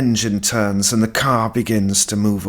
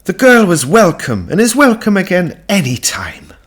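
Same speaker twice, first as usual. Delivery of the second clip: high, louder heartfelt welcome; woman saying goodbye to the girl, high and earnest; warm farewell; 10 semitones higher, 8 dB louder than usual. Acted. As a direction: tell her she's always welcome. Missus Kinsella farewell.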